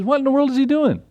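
high-pitched incredulous question; mock disbelief. Retelling David's indignant question.

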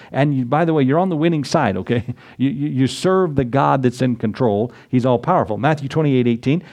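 usual speaking voice

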